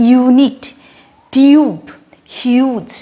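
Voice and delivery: "Unit, tube, huge" with yoo pressed hard; stress on U. Shown as a demonstration. Teacher showing first-vowel stress, strong yoo in "unit, tube, huge". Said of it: "চাপ দিয়ে উচ্চারণটা করছি".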